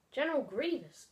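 ordinary talk, nothing marked

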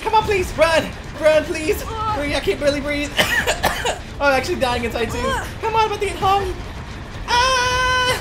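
high, frantic mock begging; hammed-up pleading. Player about to get hung, frantic playful pleading. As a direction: beg teammates to hurry, squealing with laughs.

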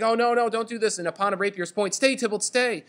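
high-pitched, hammed-up panic; terrified Juliet. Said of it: "she's terrified. She's like".

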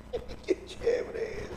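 high, soft, drawn-out little delight; delighted Pedro. Acted. Pedro thrilled to be walking on the water, high soft delight.

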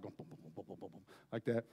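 soft breathy booms for a pounding heart; quiet mimicked heartbeat; imitated heartbeat. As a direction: thump it out like a racing heart.

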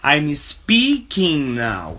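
very slow, clear, word by word; slow teaching model. Shown as a demonstration. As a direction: say it slowly so students follow. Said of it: "Eu estou o quê?".

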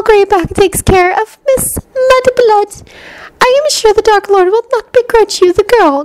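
very high-pitched, taunting villainess; Bellatrix. A narrator's reading. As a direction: toy with your captives in a high voice. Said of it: "Bellatrix said softly".

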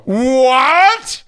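high, loud, incredulous yelp; mock outrage. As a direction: burst out in exaggerated disbelief. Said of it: "And I go".

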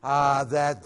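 normal talking voice

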